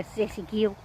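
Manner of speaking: ordinary delivery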